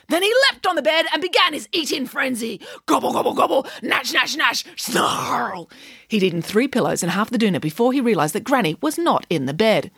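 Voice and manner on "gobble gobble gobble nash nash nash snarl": gobbling snarls. A narrator's reading. Narrator voicing wolf eating.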